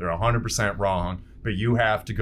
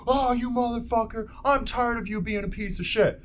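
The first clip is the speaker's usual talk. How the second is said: higher, overplayed fake fury; mock angry outburst; 11 semitones higher, 4 dB quieter than usual. Shown as a demonstration. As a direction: play the obvious hothead who broadcasts anger. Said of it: "that's not that scary".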